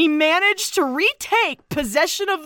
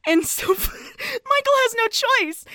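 ordinary talk

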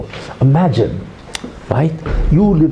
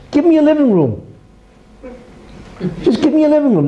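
pleading; 14 semitones higher, faster than usual. Retold or imitated. Neighbor asks for compromise.

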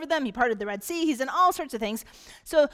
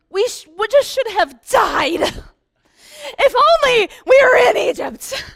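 put-on loud, high, crying complaint like a dramatic teenager; whiny Israelites; 10 semitones higher, 11 dB louder than usual. Preacher mocking the grumbling Israelites, loud crying wail.

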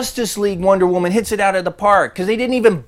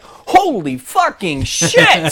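very slow, drawn-out mock disbelief; retold appalled reaction; much slower than usual. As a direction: stretch out each word of the curse. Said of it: "I was like".

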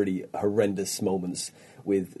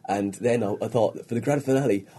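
ordinary talk, nothing marked

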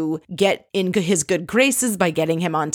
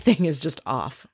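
ordinary talk

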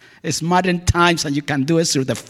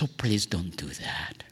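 whispered, lower please don't do that; 8 semitones lower, 13 dB quieter than usual. Retold or imitated. The woman at the pizzeria begs him, whispered. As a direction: hush him gently so he stops crying. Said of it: "she goes".

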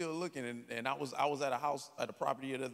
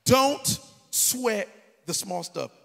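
loud and slow, each word hammered out; preached motto; 4 semitones higher, 10 dB louder, much slower than usual. Acted. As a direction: preach it big and drawn out to the crowd. Mother's motto, preached to crowd.

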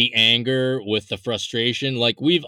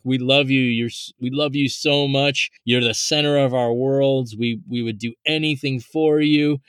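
usual speaking voice

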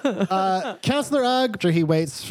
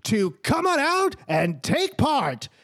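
high counselor announcement voice; 8 semitones higher than usual. Acted. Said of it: "Counselor Ugg says".

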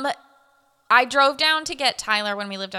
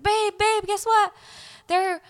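excited; 8 semitones higher, 4 dB quieter than usual. Retold or imitated. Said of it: "I was like".

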